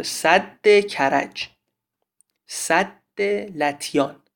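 slow "سد" with a held, doubled d before the next word; doubled consonant. Shown as a demonstration. Teacher gives dam names as examples, "سد" said with doubled d. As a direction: lean on the long d in "سد".

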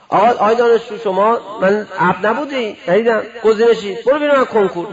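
loud mock selector barking at a student; mock gatekeeper scolding. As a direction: throw the student out like a strict examiner.

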